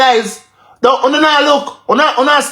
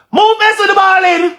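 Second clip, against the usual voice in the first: sudden shout; 9 semitones higher than usual. Real, not acted. Angry rant about the balling.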